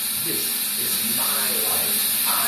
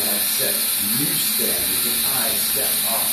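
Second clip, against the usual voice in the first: ordinary talk, nothing marked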